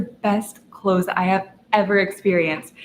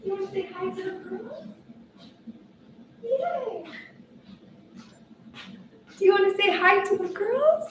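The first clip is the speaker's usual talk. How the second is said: much higher, sing-song coaxing voice; 10 semitones higher, 7 dB quieter than usual. Real, not acted. Coaxing her puppy to greet the girls, high baby talk.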